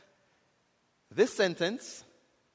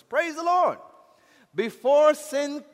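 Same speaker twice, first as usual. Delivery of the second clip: loud, raised voice with drawn-out key words; emphatic preaching; 6 semitones higher, 7 dB louder, slower than usual. Real, not acted. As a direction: proclaim it slowly and forcefully to the congregation. Preaches at full force.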